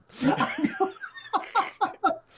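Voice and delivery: real loud burst of laughing; loud laughter. Real, not acted. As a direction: crack up helplessly at the joke. Laughs at penis joke.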